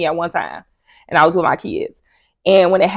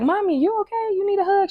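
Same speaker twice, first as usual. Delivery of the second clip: put-on high caring little girl voice; caring child voice; 11 semitones higher than usual. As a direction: ask like a worried small daughter, much higher. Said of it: "she always be like".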